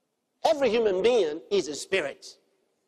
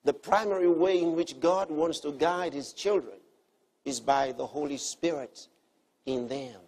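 ordinary delivery

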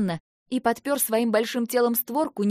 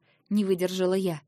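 usual speaking voice

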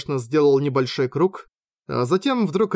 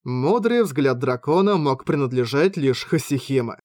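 ordinary delivery

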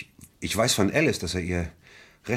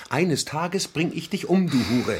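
slow threat, pitched higher; retold threat; 7 semitones higher than usual. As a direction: repeat the threat with more heat. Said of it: "der Mann drohte Alice wirklich". (gesteigerte Drohung, höher und langsamer)

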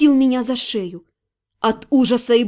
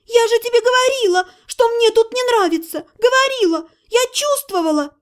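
high wailing lament, voice pitched up; 8 semitones higher than usual. Acted. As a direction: wail it out in panic, higher throughout. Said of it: "заголосила". (причитает высоким голосом)

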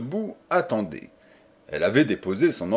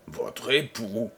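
un grondement lent et sourd; lâcher le mot avec mépris, lentement; grondé; 5 dB quieter, slower than usual. Acted. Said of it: "gronda le connétable". (le connétable gronde, poings serrés, lentement et sourdement)

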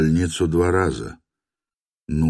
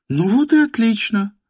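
«Ну вот и отлично» in a much higher woman's voice; 3 dB louder than usual. A narrator's reading. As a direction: say «Ну вот и отлично» as her, pitched high. («Ну вот и отлично» женским голосом)

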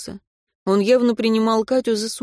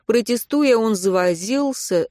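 usual speaking voice

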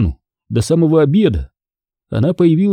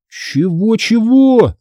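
high, drawn-out baffled question; taken aback; 9 semitones higher, much slower than usual. A narrator's reading. Boy stunned by Hanna's new plan, taken aback. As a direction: stretch it out in disbelief. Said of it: "опешил я".

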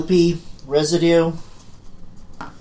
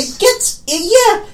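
squeaky high; 5 dB louder, slower than usual. Real, not acted. Sudden high excited squeak.

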